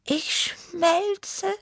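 high-pitched tiger character moaning in the heat; high, wilting groan; groaning Tibi. A narrator's reading. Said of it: "stöhnte Tibi".

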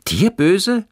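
high, loud, astonished question; startled exclamation, pitch leaping up; calling out. A narrator's reading. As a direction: cry it out in disbelief. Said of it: "rief Johannes".

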